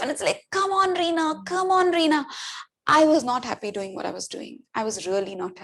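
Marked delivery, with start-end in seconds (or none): 0.5-2.2 self-urging. Retold or imitated. Dragging herself to work.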